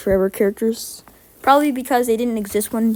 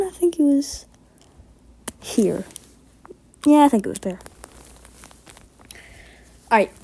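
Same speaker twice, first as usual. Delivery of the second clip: ordinary talk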